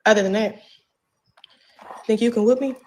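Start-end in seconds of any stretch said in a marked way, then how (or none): none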